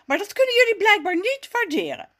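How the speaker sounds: high, loud, offended snapping; snappish. A narrator's reading. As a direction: snap back with hurt pride. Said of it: "Hij snauwt". (beledigd snauwen, hoog en luid)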